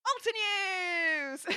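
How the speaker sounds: high, very slow and drawn out; delighted drawn-out quip; playful pun. Real, not acted. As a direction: savour the pun. Punning on a chat name.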